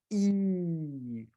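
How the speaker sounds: E stretched as a long sound; teacher modelling the long vowel E; long E demo. Shown as a demonstration. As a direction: draw out E slowly.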